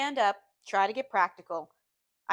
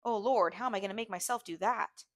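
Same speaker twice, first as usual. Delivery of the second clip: plain reading voice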